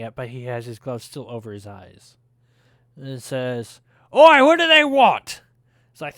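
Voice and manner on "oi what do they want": much higher, loud call; grumpy brother shouting back; singed dwarf yelling a question; gruff shout. Acted.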